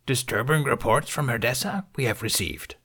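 odd ancient sage timbre, inverted phrasing; Yoda voice. Acted. Yoda confronting Ben, grave.

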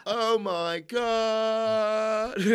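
drawn-out, high, hammy line read; mock cringe actor. Mocking a cringe film moment, drawn out. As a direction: overact it like a terrible movie.

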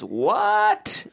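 "what?" shot up very high and drawn out; stretched squeal. Shown as a demonstration. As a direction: squeak "what?" as the letters pile up. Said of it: "until it becomes".

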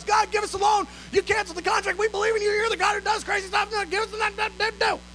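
high-pitched mock pleading; frantic prayer. Imitating staff praying every two hours, frantic pleading. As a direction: rattle it off high, trailing into babble.

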